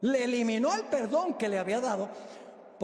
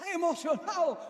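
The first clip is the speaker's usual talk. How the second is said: mock excitement; 8 semitones higher than usual. Describes the forgiven man's joy.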